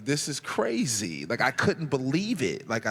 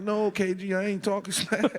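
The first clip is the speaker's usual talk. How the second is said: raised, laughing denial; nervous denial; 7 semitones higher than usual. Retold or imitated. Rookie insisting to KG, nervous laughing denial. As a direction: deny it sheepishly.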